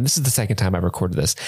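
usual speaking voice

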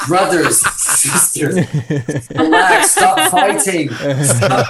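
hammed-up English accent, pitched high; mock English accent. Bandmate calming a political fight on the bus, mock English accent. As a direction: plead like a peacemaking English rocker, half laughing. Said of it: "just gave an English accent".

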